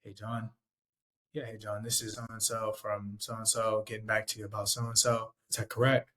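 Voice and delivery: subdued, deflated phone-rep pitch; low-energy sales call. Shown as a demonstration. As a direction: open the call quietly and down low. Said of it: "It's very different".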